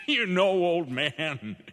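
laughs through the words, slow and easy; chuckling at the old man, unhurried; slow amused drawl with a laugh in it; laughing salesman. Acted. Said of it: "And the salesman laughed".

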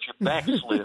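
folksy Southern preacher twang, laughing; Southern accent. Shown as a demonstration. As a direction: say backslid with a Southern drawl. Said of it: "I had to say that with my Southern accent".

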